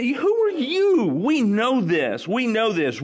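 high-pitched, scornful insistence; indignant retort. Acted. Questioners cursing the healed man, indignant and superior. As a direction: put him down, pitched well up.

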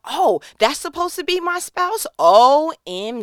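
high, loud, drawn-out thrilled exclamation; thrilled squeal. Acted. Realizing he's the spouse, high loud thrilled squeal. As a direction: squeal it like you just found the one.

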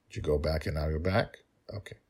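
usual speaking voice

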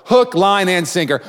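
high, loud triumphant call; excited. Acted. Preacher celebrating the woman being hooked, excited. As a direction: call it out like a big catch.

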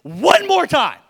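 loud, high mock fury; mock yell at a nagging child; dad pretending to threaten burning the toys; hammed-up angry parent. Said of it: "I'm like".

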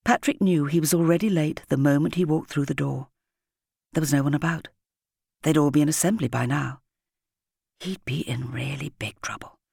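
ordinary delivery, nothing marked

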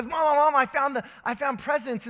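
bursting with the big news; excited kid calling. Retold or imitated. Son voiced calling his mom.

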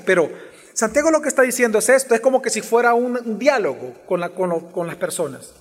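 normal talking voice

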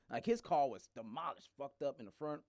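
usual speaking voice

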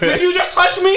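high, loud put-on offended voice; hammed-up affronted passerby; mock outrage. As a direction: squawk in pretend offence at being touched. Acts out offended New York woman.

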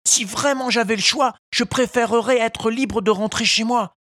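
high, loud little puppet; a wooden boy begging to go home; pleading puppet. A narrator's reading. Pinocchio pleading. (voix de pantin suppliante)

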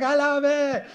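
put-on a thrilled tiny girl gushing with a giggle; little girl. A three-year-old girl in line gushing about the ride, high and giggly. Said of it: "And she's like".

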